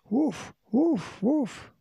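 high-pitched spoken woofs; dog barking. A narrator's reading. Timmy the dog barking to alert rescuers, high imitated bark.

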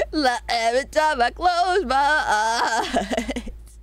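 slow words through laughter; laughing. Real, not acted. Laughing at her own JoJo joke.

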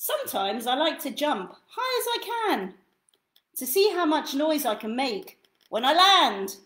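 gentle lively storybook read; reading to children. Acted. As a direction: read it like bedtime for a toddler. Reads second book aloud.

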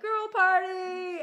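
hammed-up party squeal; mock party cheer. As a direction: cheer it like a giddy girls' night. Solomon's wives voiced, joking.

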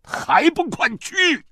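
very high, loud yell; angry stamping order. A narrator's reading. Father orders his reluctant daughter inside, yelling angrily. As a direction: shout it with a stamp of the foot. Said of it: "使劲儿跺了下脚，".

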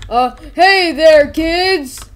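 big, high drawn-out stage greeting; loud mascot hailing a crowd; Fredbear. Acted. Mascot greeting bored kids, loud and high. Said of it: "Fredbear, uh, the kids are bored".

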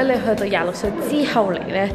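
normal talking voice

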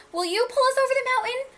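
high, urgent storybook plea; pleading cry. A narrator's reading. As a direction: plead for help, stretching the last words. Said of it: "cried the dolls and toys".

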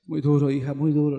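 husband whimpering to his wife, understated; mock sorrowful sigh. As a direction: moan it like a sad husband. Husband complaining to wife, retold.

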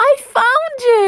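high, loud, delighted shout; overjoyed high-pitched exclamation; joyful cry. Acted. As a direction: cry out at finding a friend. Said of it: "he cried".

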